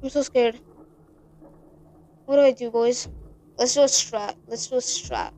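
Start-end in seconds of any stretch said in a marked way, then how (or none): none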